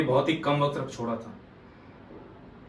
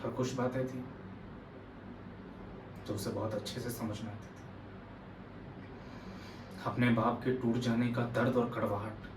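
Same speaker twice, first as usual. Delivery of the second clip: subdued and heavy, much softer; hushed, sorrowful; 11 dB quieter than usual. Acted. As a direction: let the voice sink quietly into painful memory. Monologue recalling a dying father's pain.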